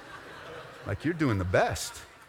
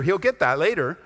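usual speaking voice

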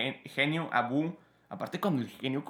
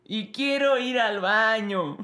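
put-on high, slow, whining child; whiny kid voice; 8 semitones higher, 4 dB louder, slower than usual. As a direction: whine like a tired boy. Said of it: "la escena de".

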